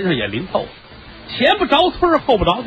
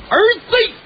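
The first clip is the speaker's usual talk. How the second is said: very high, piercing cry of one word; sharp call; 14 semitones higher than usual. Retold or imitated. Voice in his ear calls the son awake, high sharp cry. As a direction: cry out suddenly for your son. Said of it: "耳朵边儿真真的有这么一声".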